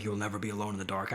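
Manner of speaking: low, soft and sinister, "You'll never be alone in the dark." as a creepy threat; threatening whisper-like menace on "You'll never be alone in the dark."; malicious. Shown as a demonstration. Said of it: "not in, like, a malicious way".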